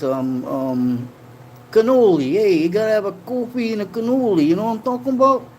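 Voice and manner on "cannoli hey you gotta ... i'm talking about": high-pitched Italian-American wise guy; mock Italian-American accent. Streamer riffs on having coffee, hammed-up Italian-American accent. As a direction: ham up an East Coast Italian accent. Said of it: "I am Italian".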